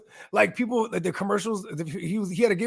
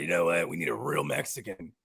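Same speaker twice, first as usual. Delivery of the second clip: much lower, dropped mock executive; mock deep exec voice; 12 semitones lower, 6 dB quieter than usual. Beer company boss mocked, deep and low. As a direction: growl it like a corporate boss. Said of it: "they're like".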